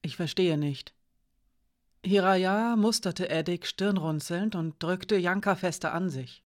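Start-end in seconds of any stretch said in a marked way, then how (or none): none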